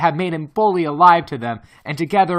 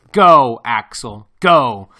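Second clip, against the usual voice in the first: loud, harsh and threatening; violently excited; 3 semitones lower, 7 dB louder, slower than usual. A narrator's reading. Professor refusing to turn back, harsh threatening shout. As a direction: fling it at him, refusing to give up. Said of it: "My uncle was violently excited".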